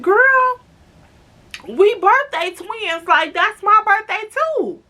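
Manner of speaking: high, excited retold exclamation; excited reveal. Storyteller tells new friend they are birthday twins, excited.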